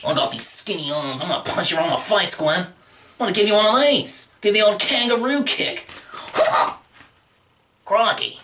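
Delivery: lightly voiced Australian bloke, fast; a hint of a cocky Australian brawler; Australian tough guy. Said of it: "just 'cause I'm Australian".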